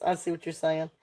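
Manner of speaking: usual speaking voice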